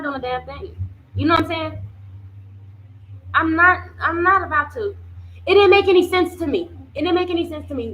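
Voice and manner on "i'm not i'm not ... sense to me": louder, higher first take of the line; emphatic outburst. Real, not acted. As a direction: push it out in disbelief. Indignant repeat of own point.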